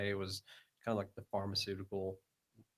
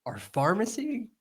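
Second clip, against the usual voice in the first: higher, louder, breaking into laughter; laughing retelling; 5 semitones higher, 9 dB louder than usual. Retold or imitated. Worker sends old lady to the pharmacy, laughing. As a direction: try to get the polite reply out through laughter.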